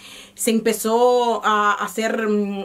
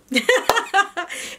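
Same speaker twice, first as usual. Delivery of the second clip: short laugh; 9 semitones higher than usual. Real, not acted. Laugh about backpacking husband.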